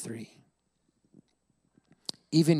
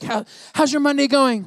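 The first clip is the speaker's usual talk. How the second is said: much higher, loud bright mock question; cheery pastor question; 12 semitones higher, 7 dB louder than usual. Pastor imitating Pastor Tim's upbeat Monday question. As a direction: chirp it like an overly upbeat colleague. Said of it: "he's always like".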